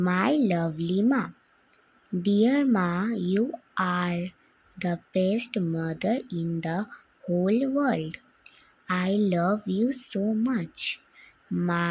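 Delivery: slow, loud letter reading. Real, not acted. Daughter reading her letter to mum aloud, slow. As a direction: read it out slowly, word by word. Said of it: "তোমাদেরকে পড়ে শোনাই".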